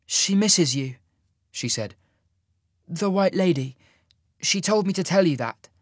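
put-on gentle young woman. A narrator's reading. Sasha gently passes message.

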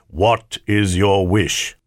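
very slow, solemn demand; spirit of the ring. A narrator's reading. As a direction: cut in with slow, weighty words. Spirit cuts in.

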